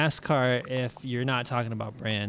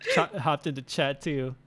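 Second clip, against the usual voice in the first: laughing; 3 semitones higher than usual. Real, not acted. Girlfriend joins chat, amused.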